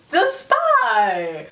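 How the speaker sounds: high, drawn-out melodrama; playful suspense climax; mock dramatic reveal. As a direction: build to a high, hammed-up announcement. Mock dramatic reveal, third repeat.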